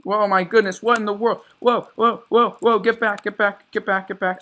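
high, loud frantic yelling; panicked shouting. Real, not acted. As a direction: shout them away in panic. Ambushed, shouting at attackers.

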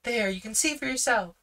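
put-on high, fast, eager girl's voice; a confident girl speaking up; young girl Judy. Judy defends her brother to the policeman, high and eager. Judy's lines.

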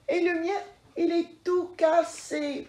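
high, mock-tearful cub voice; a touch of hammed-up crying; little bear crying. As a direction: whimper like a small cub. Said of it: "pleure petit ours".

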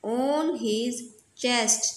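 very slow, word by word; slow reading aloud. Shown as a demonstration. Teacher reading the story line to students, very slow. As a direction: read it slowly so the class follows. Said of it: "reading aloud to class".

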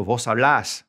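"hablas" with the last a stressed; final-syllable stress. Shown as a demonstration. Teacher repeating the vos form, stress on the end of "hablas". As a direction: punch the second syllable of "hablas". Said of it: "gotta put an accent over that last vowel".

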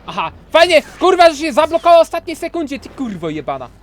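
high, loud furious yelling at the game; furious gamer rant. Real, not acted. Gamer raging at the boss blocking every hit, furious high yelling. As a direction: scream your rage at the cheating boss.

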